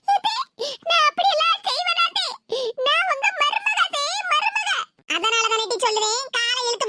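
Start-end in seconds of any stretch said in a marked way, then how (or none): 0.1-4.7 high-pitched, mock-tearful protest; wailing plea. Daughter-in-law protesting to mother-in-law that she means no harm, wailing plea. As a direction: whine it high, close to sobbing. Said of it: "கதறுதாவோ".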